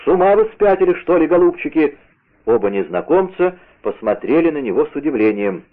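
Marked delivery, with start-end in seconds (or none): 0.0-2.0 high-pitched scolding question; indignant outcry. A narrator's reading. D'Artagnan scolding the two arsonists, indignant outcry. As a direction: scold them in a raised narrator's voice. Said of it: "вскричал он".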